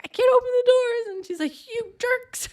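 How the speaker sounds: high, loud whiny complaint; mock whining. Trapped friend scolding the others, loud high whine.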